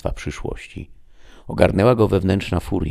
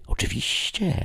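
obedient assent; 12 semitones higher, 6 dB quieter than usual. A narrator's reading. Snake operator accepting the chief's order, softly obedient.

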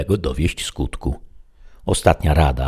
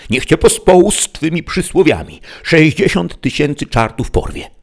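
much higher and louder, raging; furious cursing; 11 semitones higher, 6 dB louder than usual. A narrator's reading. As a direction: hurl the curse at him. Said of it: "zawołał Don Quixote".

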